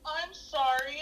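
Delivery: soft, slow imitated child's apology; small, halting mock-tearful voice; near tears. Kindergartner apologising to the teacher for stealing, imitated near tears. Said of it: "looked like he was gonna cry".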